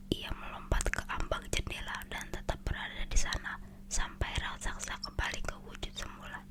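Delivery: usual speaking voice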